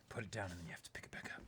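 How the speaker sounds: fast mock-secret whisper; spy whisper. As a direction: hush it like a spy in a movie. Spy movie joke, hushed.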